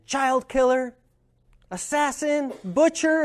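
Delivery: high, pointed insults thrown one after another; angry heckling. Retold or imitated. Second heckler joining in, shouting high insults. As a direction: jeer each name like an accusation. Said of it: "somebody else chimed in".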